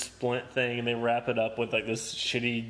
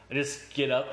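normal talking voice

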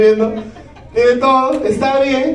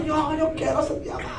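excited cry; 5 semitones higher, 11 dB quieter than usual. Retold or imitated. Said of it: "Yo decía".

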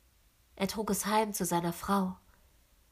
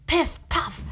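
loud, high gunshot pops; gunshots imitated; 9 semitones higher, 8 dB louder than usual. A narrator's reading. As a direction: bang out the shots much higher. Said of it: "erscholl es wieder".

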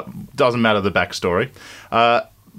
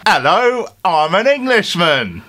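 high, slow, hammed-up English accent; a loud, stretched mock Englishman; put-on English accent; 7 semitones higher, 4 dB louder, slower than usual. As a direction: overdo an English accent, drawn out. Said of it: "number six is accents".

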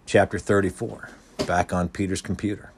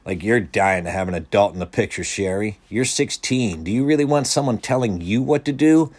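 mildly scornful, building higher and louder; narrated scoff rising into a louder question; snorting derision; 3 semitones higher, 7 dB louder than usual. A narrator's reading. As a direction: challenge her with a touch of scorn. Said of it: "Clarence snorted".

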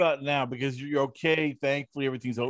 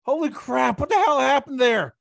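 higher and louder, a hammed-up gasp of alarm; shocked outburst; 10 semitones higher, 6 dB louder than usual. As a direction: play a stunned nurse seeing the blood. Imagined staff reacting to bloody foot.

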